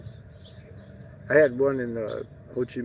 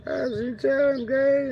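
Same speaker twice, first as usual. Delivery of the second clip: much higher, bright put-on greeting voice; mock cheery greeting; 13 semitones higher, 4 dB louder, slower than usual. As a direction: chirp the greeting way up high. Mock greeting to rich Vietnamese.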